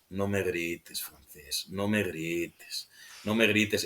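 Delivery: very low, hushed character quote, drawn out; imitating restrained menace. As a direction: keep it far below a shout, slow and quiet. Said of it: "no pega ni medio grito".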